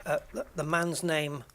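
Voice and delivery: normal talking voice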